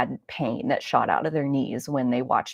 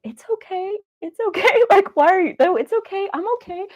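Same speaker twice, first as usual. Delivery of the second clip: grandma soothing; 6 dB louder than usual. Retold or imitated. Grandma reassuring the crying grandchild, high soothing voice. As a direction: coo it like a hurt grandma hiding her pain. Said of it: "And she's then like".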